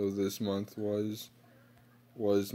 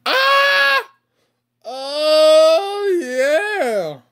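high, loud cry of joy; excited scream; 12 dB louder, much slower than usual. Real, not acted. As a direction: shriek with delight. Game excitement shout.

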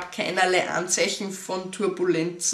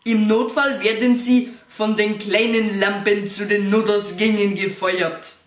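foreign crew announcement in German; 4 dB louder than usual. Retold or imitated. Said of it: "die Ansagen auf Deutsch zu machen".

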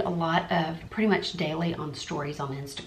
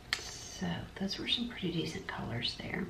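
whisper; 11 dB quieter than usual. Real, not acted. Muttered aside after unboxing.

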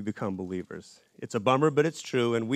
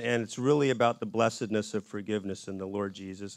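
ordinary talk, nothing marked

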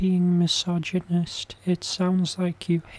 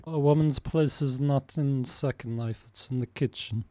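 broken-English taunt, trailing softer; a smug troll taunt in a fake accent; mock foreign accent; 4 semitones lower, 6 dB quieter than usual. A troll baiting a woman in a mock foreign accent.

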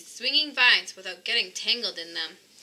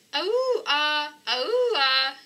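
whale call; 5 semitones higher, 4 dB louder, much slower than usual. Acted. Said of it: "The animal that needs our help says".

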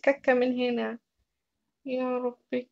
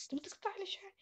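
whisper; 4 semitones higher, 17 dB quieter than usual. Acted. Complaining while the child pulls hair.

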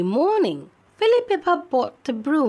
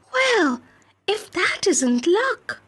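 put-on much higher, bright girl's voice; eager young maid; 8 semitones higher, slower than usual. Filippipa character voice.

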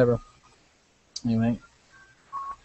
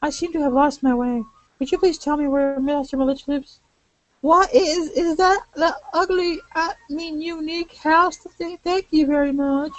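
put-on higher, polite lost young woman; high girl voice; 11 semitones higher than usual. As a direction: ask the way shyly, higher.

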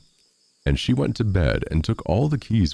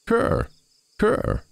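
high imitated bird croak; two short, high raven croaks. A narrator's reading. Pinched raven croaks for the miller, croaking. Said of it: "so that it croaked".

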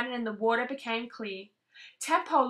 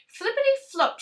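high bouncy sound words; 10 semitones higher, 4 dB louder than usual. A narrator's reading. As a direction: bounce it up like a leap.

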